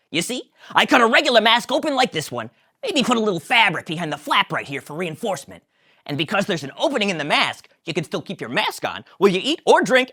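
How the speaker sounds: high, loud eager Kramer; Kramer pitching. Acted. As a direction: sell the crazy invention. Kramer pitching his mask.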